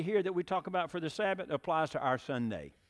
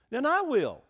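higher, louder resolve; determined hen; 9 semitones higher, 7 dB louder than usual. Acted. Hen decides to plant alone, higher firm resolve. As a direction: say it like she'll do it herself. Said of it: "she said".

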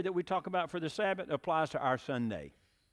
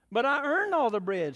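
higher, louder objection; protesting; 8 semitones higher, 6 dB louder than usual. Acted. Hen objects to the agent, higher louder protest. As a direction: insist it was her work. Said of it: "said the little red hen".

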